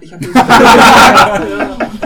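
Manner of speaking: slow, loud, laughing through it; Cartman's hammed-up apology, laughing; laughing mock apology. (übertrieben, lachend und langsam)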